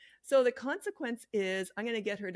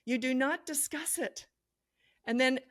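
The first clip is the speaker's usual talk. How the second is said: normal talking voice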